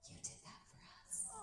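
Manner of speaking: very soft, hushed whisper. Retold or imitated. Son whispering to his mother at the class, hushed. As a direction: whisper it privately to a parent.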